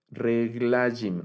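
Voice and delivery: "reglajim" very slowly, stressed on "la"; penultimate stress. Shown as a demonstration. Teacher giving dual examples, "reglajim" stressed on the second-to-last syllable "la". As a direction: stretch "la" in "reglajim", then fall. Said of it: "el acento va en la penúltima sílaba".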